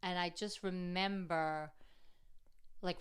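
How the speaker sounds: ordinary delivery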